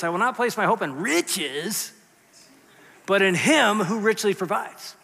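slow declaration with "not" and "him" stressed; slow, rising anthem line with weight on "not". Shown as a demonstration. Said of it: "I want you to say that like this".

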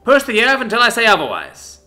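loud, high command; barked order. Acted. Sergeant ordering trainee to keep doing push-ups, loud. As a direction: drive it home hard.

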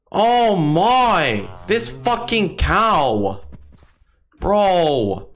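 high, drawn-out exclamations; real exasperated shock. Real, not acted. Gamer startled by a cow in his game, high exasperated shock. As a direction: cry out high in exasperated alarm.

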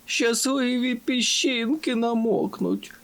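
high, slow fretful cry; worried. A narrator's reading. Sandy worrying about his lucky sand grains, anxious. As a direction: fret aloud high, stretching the words. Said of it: "хвилювався Санді".

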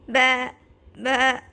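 loud bleating baa; sheep baa. Acted. Narrator voicing the sheep, loud bleat. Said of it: "The sheep said".

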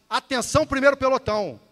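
loud, high, sustained platoon call; command voice. Shown as a demonstration. New sheriff addresses his first platoon, command voice. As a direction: project a call to attention across the ranks. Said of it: "e falar assim".

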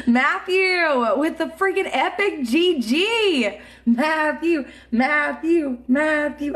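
announcer voice. Pretend. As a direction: call the name like a host. Announcer-style shoutout to viewer.

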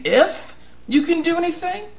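high, disbelieving question; incredulous repeat of the father's doubt; incredulous echo. Retold or imitated. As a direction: throw the doubt back, sharply rising. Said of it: "And you're saying".

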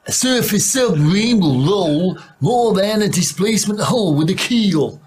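put-on American accent. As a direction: ham up the American accent, pitched high. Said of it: "I've got a better Amer-, American accent than you".

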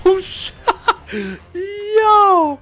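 loud, high shrieks of alarm; panicked excitement. Real, not acted. Gamer swarmed by enemies, yelping loud and high. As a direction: yelp in laughing panic.

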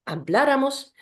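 habláramos with the stress on the second syllable, BLÁ; stressed stem vowel. Shown as a demonstration. Teacher drilling nosotros forms for learners, habláramos stressed on BLÁ. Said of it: "akcent będzie zawsze".

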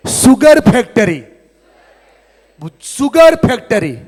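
loud, slow, high preacher's call; fiery preacher leading a chant; rallying shout. Acted. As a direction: belt it out to the hall. Rallying crowd to repeat.

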